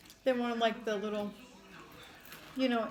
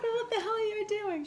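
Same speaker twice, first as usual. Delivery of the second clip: very high, incredulous squeal through laughter; laughing mock exasperation; 12 semitones higher than usual. Mom retelling her question to her daughter, laughing exasperation. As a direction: imitate a baffled mom while cracking up.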